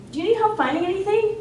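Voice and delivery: bright, high, quick helpful offer; helpful guide voice. Retold or imitated. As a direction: chirp it like an eager campus guide. Walking up to the confused man.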